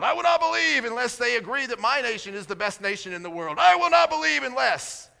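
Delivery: a stubborn petitioner laying down terms; demanding prayer. Retold or imitated. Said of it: "By that I mean".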